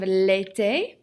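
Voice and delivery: l'été pronounced clearly for learners; model pronunciation. Shown as a demonstration. French teacher has the class repeat, l'été modelled clearly. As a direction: say l'été distinctly as a word to repeat. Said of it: "Répétez, s'il vous plaît".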